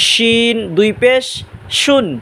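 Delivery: "শিন" drawn out slowly, with sh from mid-tongue and palate. Shown as a demonstration. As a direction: stretch "শিন", then a clear sh on "শুন". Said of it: "জিব্বার মাঝখান".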